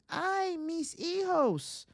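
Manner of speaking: drawn-out high wail; slow, high-pitched mock scream. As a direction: wail it long and high like a ghostly mother.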